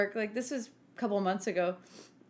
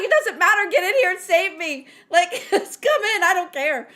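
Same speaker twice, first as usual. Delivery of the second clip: higher, louder urgent plea; desperate plea; 8 semitones higher, 8 dB louder than usual. Retold or imitated. Stuck on the toilet, begging coworker mildly loud. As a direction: beg her to come in. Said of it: "I'm like".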